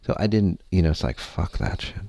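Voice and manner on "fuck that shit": a bitter, breathy whispered curse under the breath. Retold or imitated. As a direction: mutter it in despair like you've given up. Despair, giving up on everything.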